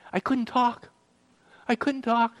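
high, strained and overcome; choked up. Retold or imitated. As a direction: relive being too overcome to speak. Reliving being too choked to speak.

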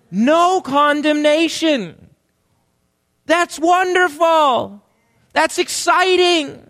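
high, exultant preacher's proclamation; jubilant, drawn-out pulpit cry; rousing enthusiasm. Real, not acted. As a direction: proclaim it slowly and high to rouse the crowd. Rousing an unenthusiastic congregation.

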